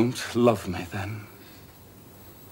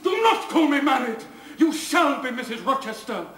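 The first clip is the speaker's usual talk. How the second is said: passionate insistence; 3 dB louder than usual. Acted. Rochester insisting Jane will be his wife, passionate. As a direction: command her to be his wife.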